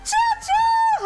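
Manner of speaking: high, loud train-whistle hoot; playful train call. Pretend. Streamer cheering the hype train along, playful shouted hoot.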